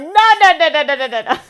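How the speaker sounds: high, loud string of mock nos; hammed-up shouted refusal; panicked refusal. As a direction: refuse in comic alarm, rapid-fire. Retold refusal of stand-up idea.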